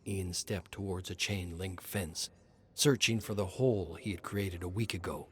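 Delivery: ordinary delivery, nothing marked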